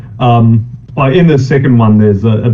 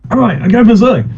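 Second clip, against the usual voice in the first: much higher, eager declaration; gleeful berserk; 10 semitones higher than usual. Retold or imitated. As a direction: announce the rampage with glee. Player gleefully goes berserk.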